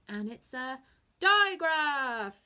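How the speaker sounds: loud, slow, booming hero announcement; superhero voice. Shown as a demonstration. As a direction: boom it out like a superhero. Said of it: "your best superhero voice".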